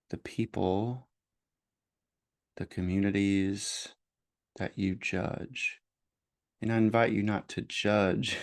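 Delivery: slow, measured meditation voice with long pauses; guided meditation. Real, not acted. Host leading listeners into noticing their judgments, guided meditation voice. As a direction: guide gently, leaving space after each phrase.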